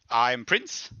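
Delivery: ordinary talk, nothing marked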